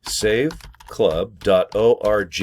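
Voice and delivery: slow demo of the link; very slow address. Shown as a demonstration. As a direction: save club dot org stretched out word by word. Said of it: "Here, I'll just show you".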